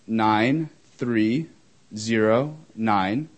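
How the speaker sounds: slow, separated digits with rising tune; slow dictation. Real, not acted. Speaker giving audience the phone number, slow dictation. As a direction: read out each digit slowly for note-takers.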